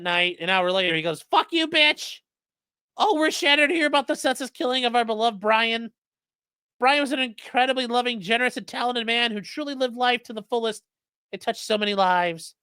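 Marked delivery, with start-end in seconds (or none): 1.2-2.2 much higher mock falsetto; castrato voice. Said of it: "doing the fucking castrati thing". 3.0-12.6 higher, lightly mocking reading voice; mock statement. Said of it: "that was from the, uh, public statement".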